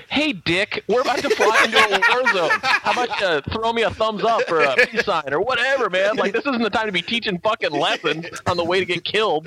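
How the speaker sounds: high, exasperated soldier's protest; mock indignant. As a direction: complain to your sergeant in disbelief.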